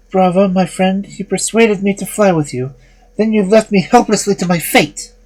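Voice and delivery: put-on high, reproachful girl's voice; indignant young woman.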